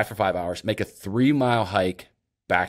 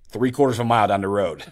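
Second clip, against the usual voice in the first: usual speaking voice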